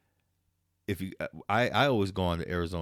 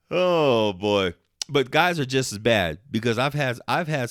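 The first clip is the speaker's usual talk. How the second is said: loud exasperated; 5 semitones higher, 7 dB louder than usual. Real, not acted. Exasperated outburst after laughter.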